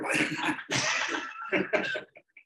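high, amused laugh. Real, not acted. Storyteller amused by a listener's version, high laugh. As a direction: burst out laughing.

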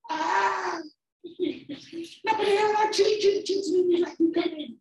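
very high, loud pig squeal; scared squealing pigs. Acted. Scared pigs refusing the wolf, shrill squealing. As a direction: squeal it in a panicked tiny pig voice. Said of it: "started squealing because they were scared".

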